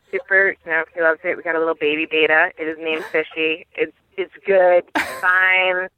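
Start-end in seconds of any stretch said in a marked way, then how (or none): none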